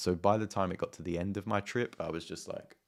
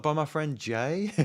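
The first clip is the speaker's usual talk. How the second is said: high, slow, innocent answer; sing-song high and slow innocent answer; mock naive reply; 9 semitones higher, 5 dB louder, slower than usual. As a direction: say it as if everyone knows Jay. Naive answer to haircut question.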